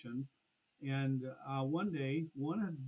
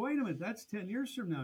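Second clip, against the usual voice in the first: higher-pitched dawning realisation; retold surprise; 7 semitones higher, 4 dB louder than usual. Agent catching the start date, retold surprise. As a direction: react as if it just clicked.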